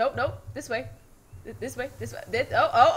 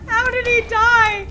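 shocked; 11 semitones higher, 9 dB louder, slower than usual. Real, not acted. Reacting to death in game.

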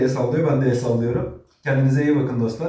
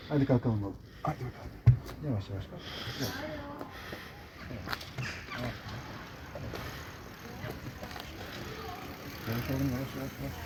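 gentle coaxing; 13 dB quieter, faster than usual. Real, not acted. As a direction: coax him up gently. Helping injured dog stand up. (yumuşak ve sakin teşvik)

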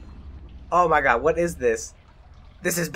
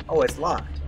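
ordinary delivery

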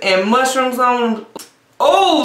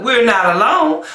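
usual speaking voice

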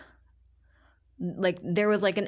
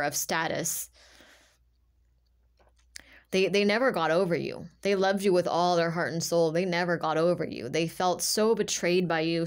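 normal talking voice

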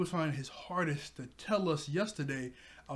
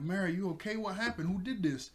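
freaking out; 4 semitones higher than usual. Retold or imitated. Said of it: "Kaya's freaking out".

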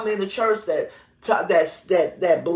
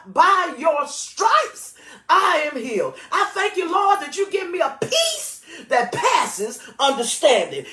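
high, impassioned preaching prayer; authoritative heartfelt declaration; 5 semitones higher than usual. Shown as a demonstration. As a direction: declare it with emphasis, voice pitched well up. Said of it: "I'm saying that from my heart".

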